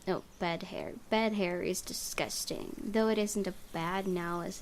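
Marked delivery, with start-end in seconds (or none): none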